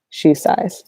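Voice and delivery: usual speaking voice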